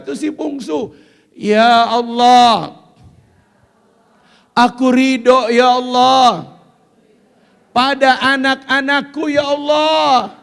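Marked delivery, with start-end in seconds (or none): 1.3-10.4 very slow, loud, drawn-out cry to Allah; loud slow prayer. Acted. Preacher leading the mothers in a crying prayer. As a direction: stretch every Allah out.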